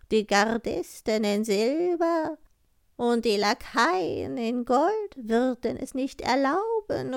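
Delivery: high, slow crow voice with loud caws; raspy cawing crow. Acted. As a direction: croak it proudly like a talking crow. Crow speaks in the tale.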